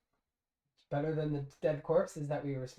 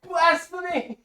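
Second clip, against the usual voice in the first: very high, loud, drawn-out shriek; hammed-up scandalized cry; mock outrage; 12 semitones higher, 9 dB louder, much slower than usual. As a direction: shriek it like a horrified zealot.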